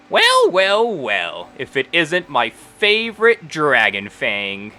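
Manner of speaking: high, drawn-out opening sliding down into a slow greeting; halfling woman greeting. Acted. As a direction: play a halfling proprietor making a show of a favourite customer. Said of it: "the halfling looks up and she goes".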